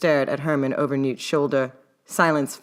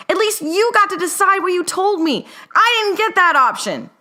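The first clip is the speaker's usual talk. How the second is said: indignant yell; 6 dB louder than usual. A narrator's reading. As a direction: drag it out in outrage. Newt yells, waving arms.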